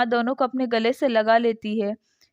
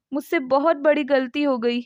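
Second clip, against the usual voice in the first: plain reading voice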